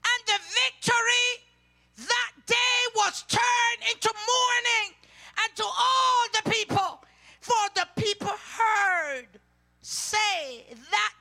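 preacher's rising cry; fiery preaching. Acted. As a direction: thunder the verse out to the church. Preaching scripture at full force.

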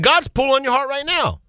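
high-pitched, pressing appeal; urgent appeal. Real, not acted. Preacher appeals to a wavering listener, high and urgent. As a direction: press it home with the pitch lifted.

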